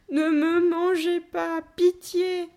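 high, loud, slow wail for mercy; crying out in terror. A narrator's reading. Child begs the gorilla not to eat them, crying out in terror. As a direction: wail a plea, stretching the words. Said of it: "m'écriai-je".

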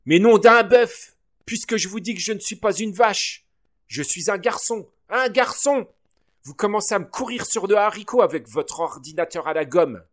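put-on boy's indignant shrill outburst; exploding in outrage. A narrator's reading. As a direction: burst out, at the end of your rope. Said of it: "et explosai".

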